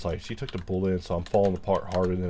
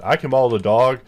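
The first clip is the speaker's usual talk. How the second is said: loud mock lament; 4 semitones higher, 7 dB louder than usual.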